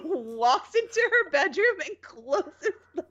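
ordinary talk

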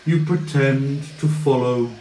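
scornful villain. Acted. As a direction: belittle the boy wizard, then go cold. Villain taunts the party.

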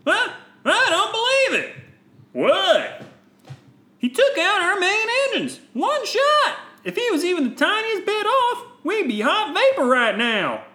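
high, bright heroic voice; young Jedi voice. Acted. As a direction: play the eager young Jedi. Voicing Jedi after shot.

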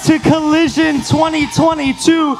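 loud, high event-opener shout; belted high-energy welcome; hype shout. Real, not acted. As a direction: fire up a big crowd with a whooping welcome. Pastor hyping up event crowd.

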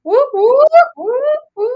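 high, loud celebratory whoops; whooping cheer. Real, not acted. Host hyping up the Housing Day episode, whooping.

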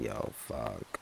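a low defeated mutter; muttered curse. Retold or imitated. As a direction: grumble it low to yourself. Mice swarming the campfire.

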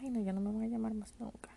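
fast, breathless whisper of giving up; whispered despair. Retold or imitated. Recalling thinking the call center would never call, whispering. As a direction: whisper it like all hope is gone. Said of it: "Incluso yo dije".

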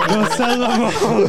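high, loud and slow through laughter; laughing scolding. Real, not acted. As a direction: tease him while still laughing. Laughing at scared friend.

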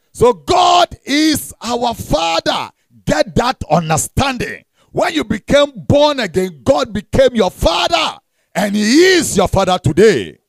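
forceful pulpit proclamation; preaching. Acted. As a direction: drive each phrase home to the congregation.

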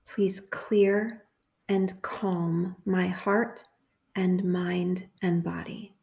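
slow, measured prayer with long pauses; guided meditation prayer. Real, not acted. Host leading listeners through a prayer for confusion, measured meditation. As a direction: lead a meditation phrase by phrase, leaving room to repeat.

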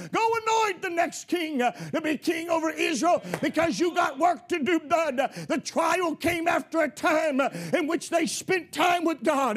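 full-throated revival preaching, rising to a shout; preaching at full force. Real, not acted. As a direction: preach it hard and build the fire.

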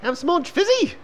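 very high squeak; high-pitched mock voice; squeaky high voice. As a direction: squeak it like a cartoon.

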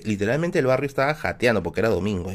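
ordinary talk, nothing marked

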